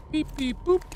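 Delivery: very high, soft beeping noises; robot beeps. Retold or imitated. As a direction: chirp like a little machine. Imitating game menu sounds.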